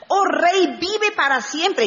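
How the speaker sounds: high, ringing sincere proclamation; heartfelt salute. Acted. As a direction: hail the king and mean it. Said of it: "este sí se lo dijo de verdad". (saludo sincero, de corazón, en voz alta)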